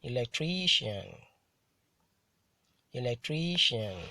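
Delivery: "electrician" with TRI hit harder; careful model reading of "electrician", stressed on TRI; penultimate stress. Shown as a demonstration. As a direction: punch TRI in "electrician", then fall. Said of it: "stressed on the penultimate syllable".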